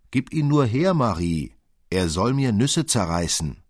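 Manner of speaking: insistent quarrelling shout; bossy demand. A narrator's reading. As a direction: demand it loudly, pitch leaping up. Fritz demands the nutcracker in a quarrel.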